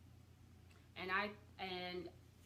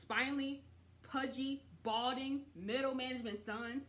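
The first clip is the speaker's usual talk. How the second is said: angry insult; 5 semitones higher, 5 dB louder than usual. Retold or imitated. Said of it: "she's like, angry".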